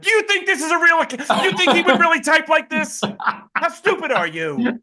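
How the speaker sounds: very high-pitched, loud mock outrage; incredulous retort. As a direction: scold gullible followers in high-pitched disbelief. Acted incredulous retort to followers.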